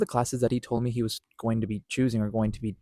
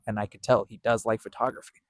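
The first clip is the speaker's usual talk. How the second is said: ordinary delivery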